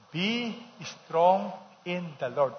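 slow, drawn-out group reading; reading together. Shown as a demonstration. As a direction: lead the congregation through each word slowly. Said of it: "Everybody, read this together".

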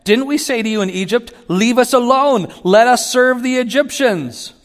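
preacher mimicking Israelites griping at Moses, high loud whine; whining Israelites. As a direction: pile it on like a sulking crowd. Mocking the complaining Israelites.

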